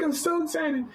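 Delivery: very high excited squeal; re-enacted high-pitched thrill; excited screaming. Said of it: "I'm screaming".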